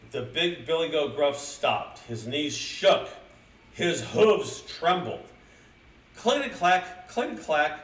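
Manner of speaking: slow down and build to a high, loud clatter; storyteller heightening the suspense; dramatic story narration. A narrator's reading.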